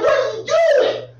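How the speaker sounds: high, outraged what are you doing; shocked scolding. Retold or imitated. Owner catching his dog, high shocked cry. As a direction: cry it out in disbelief. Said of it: "I was like".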